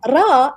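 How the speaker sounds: ra with a rolled tongue-tip r; rolled Arabic r. Shown as a demonstration. Explaining the tongue's part, rolled Arabic r in "ra". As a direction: roll the tongue on "ra". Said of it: "requires this type of rolling".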